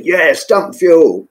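slow, high emphatic order; firm insistence. Retold or imitated. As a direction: insist on it, drawn out. Insistent radio reply retold.

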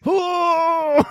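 high, drawn-out "oh"; mock moan. Host acting out someone pumping the milk, mock moan. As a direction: moan it high and long, hammed up.

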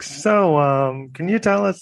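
high mock interviewer voice; lightly voiced questioner, pitched high. A lawyer asking in a high mock voice.

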